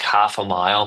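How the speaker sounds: normal talking voice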